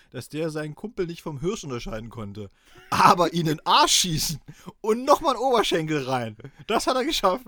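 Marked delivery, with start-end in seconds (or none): 2.9-7.5 loud, high mocking rant; louder and higher, half laughing; excited, laughing rant. Real, not acted. Mocking the hunter's aim.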